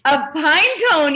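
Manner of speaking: ordinary delivery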